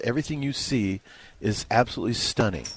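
ordinary delivery

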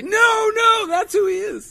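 high, loud mock viewer pleading; fan protest. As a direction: squeak it out like an insistent fan. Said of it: "other viewers say".

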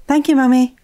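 put-on chirpy child's thank you; happy child. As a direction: say it like a delighted small son.

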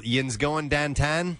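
slow Pittsburgh accent, "Yin's" for you all. Shown as a demonstration. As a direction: drawl it out Pittsburgh style. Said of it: "here's the example".

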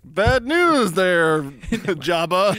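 very slow, put-on hero voice; mock movie hero. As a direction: deliver it like a smug film hero. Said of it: "I was like".

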